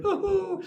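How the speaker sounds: high hooting society-lady laugh; imitated laugh. Old lady laughs at the shopper's flattery, high mock hooting. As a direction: mimic her high, airy hoo-hoo giggle. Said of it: "She laughed. She went".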